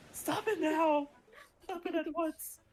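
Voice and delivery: high crying protest; distressed. Acted. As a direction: whine to make it stop. Liam distressed.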